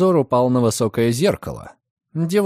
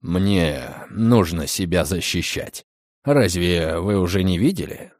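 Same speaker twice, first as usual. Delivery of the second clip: low calm male voice; 7 semitones lower than usual. A narrator's reading. Redfern explains his potions. (низкий спокойный голос)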